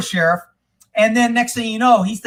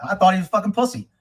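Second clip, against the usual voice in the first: ordinary talk, nothing marked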